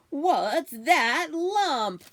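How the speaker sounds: slow, loud theatrical boom; shouted. Acted. Mister Bunny shouting at the blanket, loud theatrical boom. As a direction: bellow it just for the heck of it. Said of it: "shouted Mister Bunny anyway".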